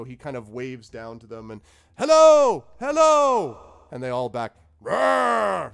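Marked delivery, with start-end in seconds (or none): 2.0-3.6 very high, loud cheery hello; sing-song shouted greeting; calling out. Acted. Wizard waves down to yetis. 4.8-5.7 high, loud mock monster roar; playful beast roar; yeti roar. Yetis roar back.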